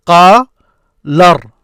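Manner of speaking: "qa" held long, pause, then "lar"; projected teacher demo, "qa" and "lar" apart; broken into syllables. Shown as a demonstration. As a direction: sound out "qa ... lar" loudly as separate syllables. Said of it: "break it down to syllables".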